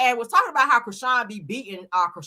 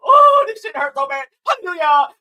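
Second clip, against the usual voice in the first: much higher and louder hammed-up cry of pain; mock pained wail; 12 semitones higher, 9 dB louder than usual. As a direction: overact the pain while getting the tattoo off. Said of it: "see it and reenact it".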